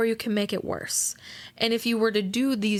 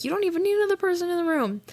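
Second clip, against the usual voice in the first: emphatic fast high aside; 10 semitones higher, much faster than usual. Real, not acted. Emphatic repeat.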